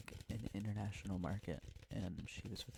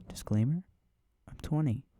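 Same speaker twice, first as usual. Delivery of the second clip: loud; 4 semitones higher, 13 dB louder than usual. Real, not acted. Loud joking disclaimer.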